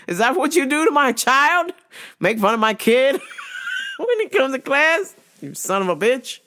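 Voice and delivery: high, hammed-up parent scolding; mock angry parent. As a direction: play the offended parent. Imagined parent scolding teacher.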